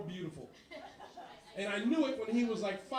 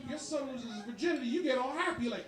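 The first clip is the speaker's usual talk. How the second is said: ordinary talk, nothing marked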